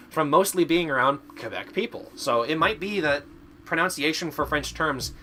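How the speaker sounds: usual speaking voice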